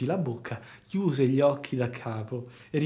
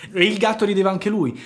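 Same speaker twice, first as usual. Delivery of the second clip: plain reading voice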